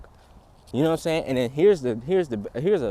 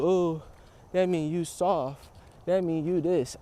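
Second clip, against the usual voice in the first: mocking elders; 5 semitones higher, slower than usual. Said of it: "They'll teach you".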